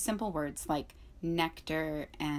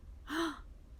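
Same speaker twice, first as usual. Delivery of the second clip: crowd gasp; 10 semitones higher, 8 dB quieter than usual. A narrator's reading. Crowd gasps.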